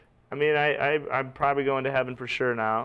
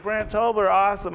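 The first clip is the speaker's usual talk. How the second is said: high-pitched playing along; a lightly hammed-up cheer for the act; mock enthusiasm; 8 semitones higher, 3 dB louder than usual. Re-enacts cheering his own introduction.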